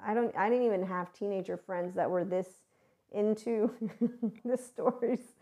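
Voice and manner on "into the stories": real giggle breaking up the phrase; amused laughing. Real, not acted. Speaker marvels at how into the stories they are, amused laughing. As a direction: laugh midway, finish higher in amusement.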